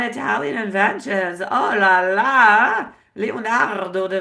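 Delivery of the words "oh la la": playful oh la la. Real, not acted. Playful exclamation about Italy.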